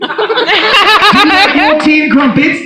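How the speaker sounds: a high, slow put-on English accent; posh English accent. Hypnotist teasing an English volunteer, mock posh English accent.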